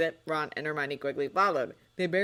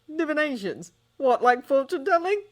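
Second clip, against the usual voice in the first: incredulous; 10 semitones higher, 8 dB louder than usual. Acted. John hears about divination.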